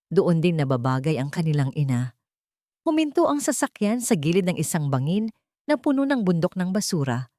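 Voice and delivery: ordinary delivery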